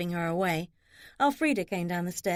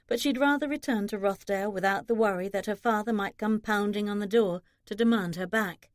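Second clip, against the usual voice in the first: ordinary delivery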